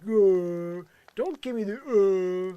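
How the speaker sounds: loud, high imitation of someone's groaning; mocking groan. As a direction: groan it out loudly, mimicking his protest. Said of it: "when you're like".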